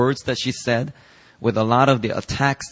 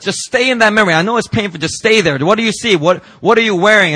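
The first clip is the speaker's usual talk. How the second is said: higher, louder, pressing gently; urgent coaxing; 7 semitones higher, 5 dB louder than usual. Retold or imitated. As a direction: urge him to stay in the memory. Retold coaxing during inner healing.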